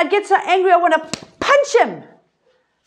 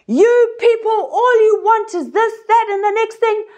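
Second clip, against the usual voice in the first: high, raised scolding of the crowd; angry scolding; 8 semitones higher than usual. Acted. Moses scolding the Israelites, high irritated voice. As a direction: snap at the people in frustration. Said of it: "Moses got in front".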